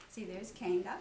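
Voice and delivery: plain reading voice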